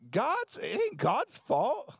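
high, slow, drawn-out exasperation; exasperated retort. Retold or imitated. The preacher reading the passage, answering with slow disbelief. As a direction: drag it out, fed up with their excuses. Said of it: "I'm like".

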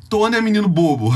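retold motherly retort, breaking into laughter; teasing mother. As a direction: chide your silly boy, amused. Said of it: "Aí ela falou assim".

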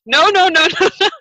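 high, loud string of denials; imitating an urgent, high-pitched reassurance; emphatic retold protest. Acting out his hurried reassurance.

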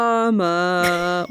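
DRAMA with the last A stretched out long; drawn-out drama. Shown as a demonstration. Co-host reading out the last note, drama stretched. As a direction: draw out the final "ah" in drama. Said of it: "with a bunch of A's".

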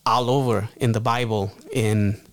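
usual speaking voice

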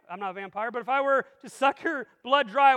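ordinary talk

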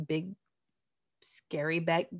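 whispered, soft and breathy; spooky whisper. Real, not acted. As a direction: hush it like a scary story. Spooky word in big bad wolf image.

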